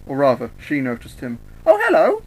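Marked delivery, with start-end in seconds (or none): 1.7-2.2 very high, loud chirp; perky, sing-out hello; cheerful. Acted. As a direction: greet them in a squeaky-high cheerful voice. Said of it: "she was cheerful".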